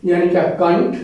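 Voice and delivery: ordinary talk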